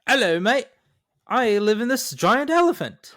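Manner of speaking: put-on high, loud Cockney kid voice; hammed-up Cockney street boy; Cockney accent. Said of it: "thick Cockney accent boy".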